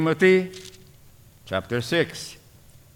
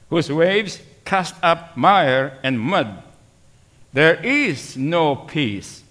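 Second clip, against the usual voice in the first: slow, solemn verse reading; scripture reading; much slower than usual. Acted. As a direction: read each phrase deliberately.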